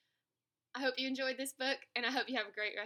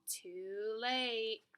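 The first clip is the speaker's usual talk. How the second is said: plain reading voice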